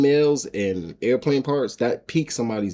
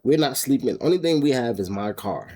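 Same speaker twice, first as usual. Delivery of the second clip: usual speaking voice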